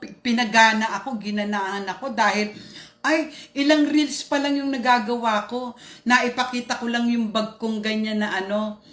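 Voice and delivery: normal talking voice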